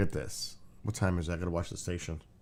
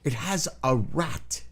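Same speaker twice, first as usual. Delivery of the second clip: shocked disbelief; 6 semitones higher than usual. Real, not acted. Shocked spider eats rat.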